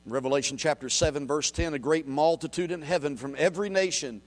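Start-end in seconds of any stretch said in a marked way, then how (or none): none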